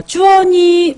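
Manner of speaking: high, loud call of a viewer's name. Real, not acted. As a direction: call the name out like across a room. (높고 큰 소리로 이름 부르기)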